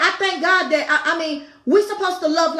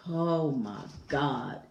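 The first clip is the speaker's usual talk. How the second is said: slow, soft, breathed out under the voice; overwhelmed sigh; 11 semitones lower, 10 dB quieter, slower than usual. Real, not acted. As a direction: let it slip out like a tired groan. Overwhelmed aside mid-sermon.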